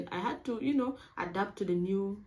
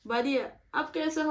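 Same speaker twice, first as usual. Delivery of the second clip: higher, slightly louder imitation of the classmates' Hindi; classmates' cheerful reply; 8 semitones higher, 4 dB louder than usual. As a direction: answer brightly like a local friend.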